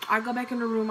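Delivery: ordinary talk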